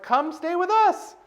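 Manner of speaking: high-pitched, sing-out invite; mock-breezy high invitation; imitated eager invitation. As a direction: quote a friend inviting, pitched right up. Friend's invitation, retold.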